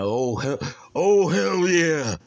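high, loud, drawn-out catchphrase yell; loud, stretched-out wrestler impression; Stone Cold Steve Austin. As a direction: belt it out like a rowdy wrestler's catchphrase. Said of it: "can't do a Stone Cold accent".

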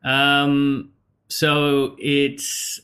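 ordinary delivery

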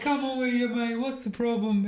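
hammed-up Italian boss accent, high and bouncy. Retelling the Italian boss to podcast friends, mock Italian accent. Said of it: "I'm really crap at it".

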